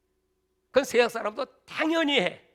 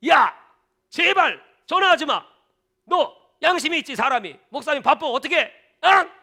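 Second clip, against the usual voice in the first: higher, louder mock telling-off; exasperated scolding; 5 semitones higher, 7 dB louder than usual. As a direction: scold a pestering caller. Pastor scolds caller on phone.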